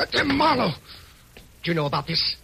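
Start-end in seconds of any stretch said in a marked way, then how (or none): 0.0-0.7 higher, stammering alarm; nervous man startled by the detective in the dark; startled cry. Acted. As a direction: caught off guard, blurt his name. Startled at being seen.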